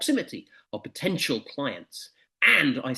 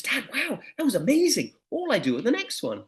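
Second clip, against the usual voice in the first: put-on higher, eager teenage boy; higher voice of an excited lad calling his dad; excited young son; 11 semitones higher than usual. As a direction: play the sixteen-year-old showing off his find. Son reports back excitedly.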